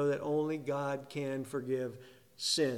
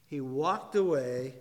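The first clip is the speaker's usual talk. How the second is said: "walked" given firm, weighty emphasis; stress on "walked". Shown as a demonstration. Preacher repeating the point about the paralytic, stress on "walked". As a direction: hit "walked", then let "away" follow. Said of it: "emphasis on the walked".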